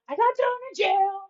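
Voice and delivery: high, whiny cartoon complaint; mad Felix. Felix sulking at Ralph about the jail, high whiny voice. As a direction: whine it like a sulking cartoon hero. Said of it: "he's so mad at Ralph".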